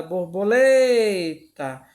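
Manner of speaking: "borboleta" slowly chanted, lingering on LE; sing-song syllable stress. Shown as a demonstration. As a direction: draw out the LE in "borboleta" as if singing. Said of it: "lemos uma forma cantada". ("borboleta" cantado devagar, demorando no LE)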